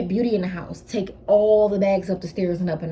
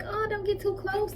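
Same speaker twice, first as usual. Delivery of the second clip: high, softer imitation of her own warning; protective mock quote; 3 dB quieter than usual. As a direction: ward them off lightly, half-joking. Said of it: "And I'm like".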